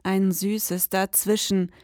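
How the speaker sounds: slow, low, dreamy narration; slow story narration. A narrator's reading. Detective narrating the strange visitor, slow and dreamy. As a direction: linger on each image, savouring it. Narrator introduces milk jug.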